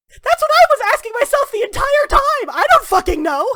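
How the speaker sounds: very high, loud exasperation; frustrated, helpless outburst; frantic shouting. Acted. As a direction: yell back at the top of your voice. Acted quarrel, yelling back.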